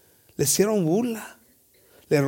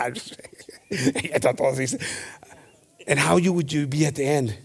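ordinary talk

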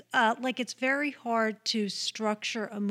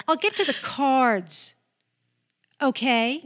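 louder stagey retake with a rising okay; weird distinctive delivery; 3 semitones higher, 6 dB louder than usual. Retold or imitated. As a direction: push the okay up, stiff and theatrical. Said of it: "Like that".

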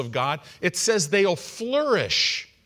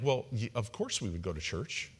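soft, low aside voicing their look; sheepish imitation; 7 semitones lower, 10 dB quieter than usual. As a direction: drop the voice for their unspoken answer. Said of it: "they're kinda looking like".

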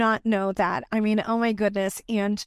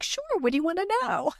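high, quick, airy imitation of her own easygoing self; breezy casual reply; 9 semitones higher, much faster than usual. Retelling to her mentee how she casually agreed, breezy and high. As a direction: toss it off lightly, as if it's no big deal.

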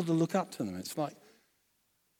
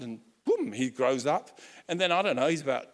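ordinary delivery

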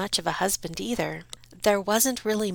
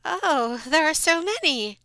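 much higher, fluttery voice; flustered mother; 9 semitones higher than usual. Acted. Mother bluffs to the Count, fluttery. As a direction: bluff brightly. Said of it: "she said".

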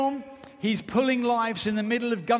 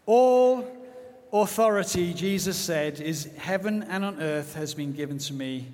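slow scripture proclamation with long pauses; solemn commissioning. Acted. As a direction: proclaim the commission in measured, emphatic phrases. Reads the Great Commission over congregation.

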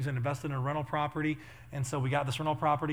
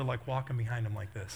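ordinary delivery

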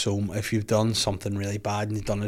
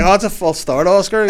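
higher, louder starter calling out; mock race official; 7 semitones higher, 7 dB louder than usual. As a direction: call a false start to the runner.

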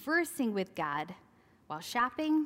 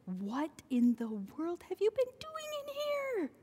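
exasperated parent scolding; 10 semitones higher than usual. Retold or imitated. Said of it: "It's like".

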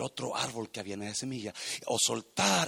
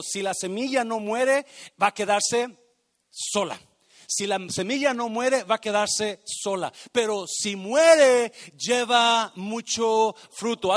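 rising, louder, emphatic preaching; fervent preaching; 7 semitones higher, 13 dB louder than usual. Acted. Pastor preaches to the church about the seed, fervent loud preaching. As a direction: build the sermon to a booming climax. Preaching builds to full force.

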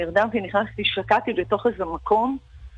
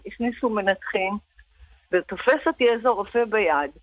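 normal talking voice

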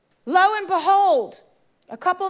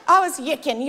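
ordinary talk

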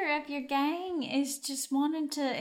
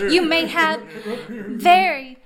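hammy flattery; 7 dB louder than usual. Acted. Captive flatters bandit, breaking up laughing.

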